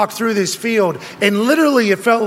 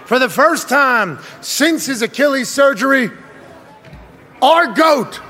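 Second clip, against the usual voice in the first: announcer intro; 4 semitones higher than usual. Acted. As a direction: bring in the star like a ring announcer. Building guest introduction.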